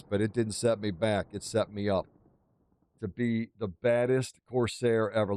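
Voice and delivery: usual speaking voice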